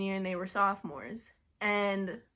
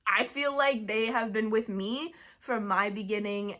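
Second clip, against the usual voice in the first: ordinary talk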